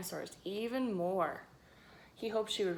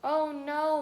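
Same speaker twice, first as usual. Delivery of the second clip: moaned; 8 semitones higher, 8 dB louder than usual. A narrator's reading. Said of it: "moaned the volunteer".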